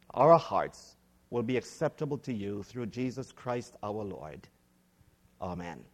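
hushed, reverent public prayer. Real, not acted. Preacher asking God to guide his words, in quiet prayer.

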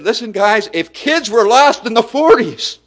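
high, loud rallying cry; impassioned declaration. Retold or imitated. Preacher quoting an old leader addressing the guys, impassioned declaration. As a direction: proclaim it at full force to the room. Acting out elder leader's statement.

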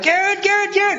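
high urgent yell of the name, retold; alarmed shout. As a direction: shout a warning at the driver. Deer jumps in front of van.

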